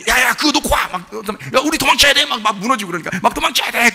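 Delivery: high, loud urgent shouting; mock shout to flee. Movie hero yelling to his friend to flee the collapsing cave, loud and high. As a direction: yell at your buddy to drop it and run.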